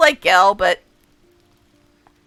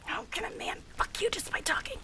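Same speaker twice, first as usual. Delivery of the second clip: a stunned, breathless whisper; whispered to herself, flustered by his voice; 9 semitones higher, 17 dB quieter than usual. Real, not acted. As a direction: barely breathe it out, overwhelmed. Flustered by the character's flirting.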